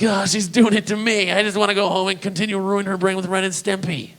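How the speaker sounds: high, nervous excuse; defensive dad. Retold or imitated. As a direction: plead your case to the staring moms. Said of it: "I'm like".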